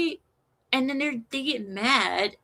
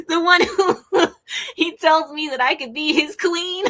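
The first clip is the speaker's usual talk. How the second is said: higher, laughter breaking into the words; laughing; 6 semitones higher, 5 dB louder than usual. Real, not acted. Host joking about a scam caller, laughing. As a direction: talk through a fit of giggles. Cracking up at own joke.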